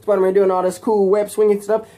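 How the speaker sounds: ordinary delivery, nothing marked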